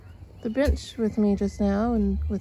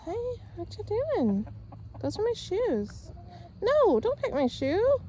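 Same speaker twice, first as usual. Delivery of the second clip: high playful scolding; 9 semitones higher than usual. Real, not acted. Scolding chicken at her shoes.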